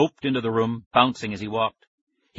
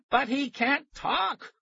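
high, slow shrieked protest; scream; much slower than usual. A narrator's reading. Tara screams at the scientist, high slow shriek. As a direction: scream it in disbelief. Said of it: "Tara screamed".

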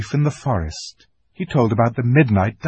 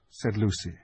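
usual speaking voice

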